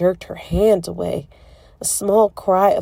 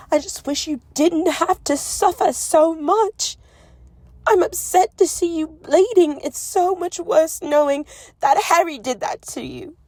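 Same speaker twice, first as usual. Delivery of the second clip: much higher, tear-choked pleading; tearful distress; 10 semitones higher, 4 dB louder than usual. A narrator's reading. Hermione grieving his pain, tearful and high. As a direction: let the tears crack through.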